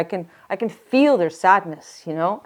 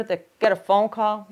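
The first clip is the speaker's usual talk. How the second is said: ordinary delivery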